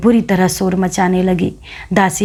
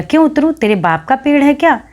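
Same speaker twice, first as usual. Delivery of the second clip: higher, cocky retort flung down from the tree; cheeky defiance; 7 semitones higher than usual. A narrator's reading. Boy in a tree sassing the old widow, cheeky and high. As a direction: taunt her, pitched up and saucy.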